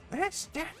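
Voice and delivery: lightly voiced high Toad. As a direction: squeak it like Toad. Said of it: "Toad giving any sort of speech".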